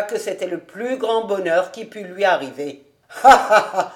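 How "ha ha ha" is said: loud acted belly laugh; hearty laugh. Cobus agrees with old David, laughing heartily. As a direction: burst out laughing in character.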